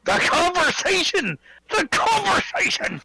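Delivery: high, hammed-up exclamation; mocking echo. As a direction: parrot his phrase back theatrically.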